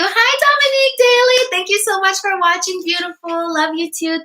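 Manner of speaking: light up as you greet her; excited greeting. Real, not acted.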